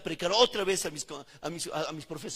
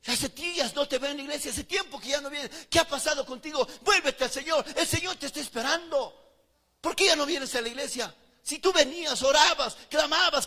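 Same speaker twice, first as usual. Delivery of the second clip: higher, louder earnest pleading; pleading sister; 9 semitones higher, 10 dB louder than usual. Retold or imitated. Sister Flor urging the straying brother back to church, higher pleading. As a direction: plead with him to come back. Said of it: "me dijo".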